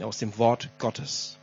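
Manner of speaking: usual speaking voice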